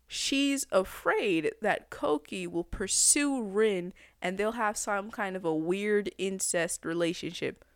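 ordinary delivery

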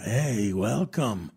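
put-on low, slow raspy old man's voice; raspy old man ghost. Ghost of an old man greeting him in the basement, low slow rasp. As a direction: croak it like a seventy-year-old ghost. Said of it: "raspy la voz como de un viejito de unos setenta".

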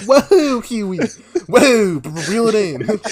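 high, loud "whoa" with laughter; amused mock shock. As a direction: rein a friend in, scandalized. Retelling own shocked reaction to Huey.

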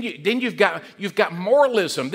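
usual speaking voice

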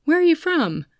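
lightly imitated stranger, pitched high; chatty stranger's question. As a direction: ask it high, as a curious seatmate would. Stranger's small-talk question acted.

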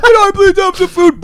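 very high, loud pleading screech; hammed-up shrieked plea; homeless man impression. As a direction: mimic a frantic man begging. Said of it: "Yeah, exactly like that".

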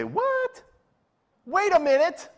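high, loud startled "What? Wait a minute."; sudden high indignant surprise; shocked outcry. Retold or imitated. Apostles catching wind of the request, shocked outcry.

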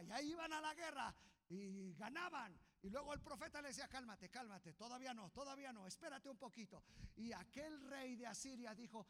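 high, loud storytelling sermon; preacher racing through the story; full-force preaching. Acted. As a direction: tell the battle story with fire.

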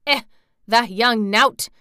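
loud, drawn-out scorn; scornful old Yorkshireman; indignant outburst. A narrator's reading. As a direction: exclaim it with indignant disbelief. Angry old man's outburst.

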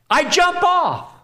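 high, drawn-out announcement; mock-heroic jumper's cry; acted daredevil leap. Acts out leaping off a building.

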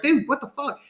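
high, fed-up outburst; arguing with a friend about number one players, heated high outburst; getting heated. Retold or imitated. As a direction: let the frustration spill over. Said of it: "I was kinda getting".

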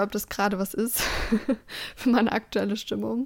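ordinary delivery

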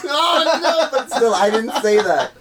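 high-pitched denial through laughter; real giggly disavowal; laughing protest. Real, not acted. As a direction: laugh while backing away from the remark. Laughing denial after a joke.

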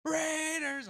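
high chant of the team; team cheer. Retold or imitated. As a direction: cheer for the Raiders. Lady cheering rival team.

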